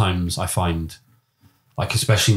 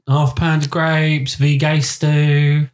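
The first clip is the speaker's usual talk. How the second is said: put-on slow Cockney barrow-boy German, pitched higher; Cockney market trader German; 8 semitones higher, 4 dB louder, much slower than usual. Cockney German teacher hawking like at a market, slow. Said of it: "Like you're at a market".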